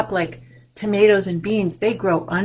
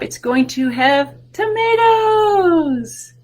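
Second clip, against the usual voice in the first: higher, slow, wonder-filled; excited reveal; 10 semitones higher, 5 dB louder, much slower than usual. Shown as a demonstration. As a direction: make tomatoes sound amazing. Building excitement for kids.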